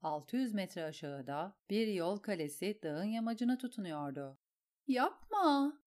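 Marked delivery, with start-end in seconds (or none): 4.9-5.8 high, pleading cry of fright; frightened scream. A narrator's reading. Sansa screams at her aunt in fright. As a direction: Sansa cries out in terror. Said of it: "diye çığlık attı Sansa".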